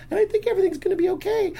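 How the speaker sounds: put-on very high, quick child voice; cute little girl. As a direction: reassure in a squeaky four-year-old's falsetto. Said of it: "this cute little blonde girl".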